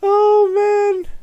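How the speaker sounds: normal talking voice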